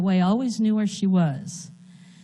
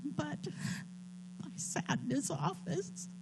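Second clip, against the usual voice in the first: soft, higher voice, fighting back tears; barely holding it together, quiet and high; near tears; 4 semitones higher, 8 dB quieter than usual. Real, not acted. Telling the church about her diagnosis, voice breaking and quiet.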